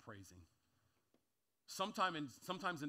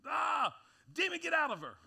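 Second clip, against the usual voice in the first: high, loud exasperated shout, acting out Paul; frustrated outburst; 10 semitones higher, 8 dB louder than usual. Paul finally casts out the demon, frustrated shout. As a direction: burst out in mock exasperation, pitched way up. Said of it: "in frustration, Paul finally is like".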